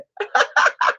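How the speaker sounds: high cackling burst; startled laugh. Real, not acted. Streamer caught out by friend in chat, laughing.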